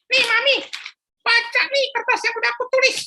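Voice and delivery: put-on high childish voice; a little boy at the shop counter; young boy. Philip speaking to shopkeeper Pak Joko, high child voice.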